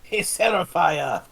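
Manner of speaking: high, loud call to someone at a distance; yelling back. Acted. Player's character to Seraphia about the wasps, yelled back. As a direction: holler it over in playful character. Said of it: "I'll, uh, yell back at".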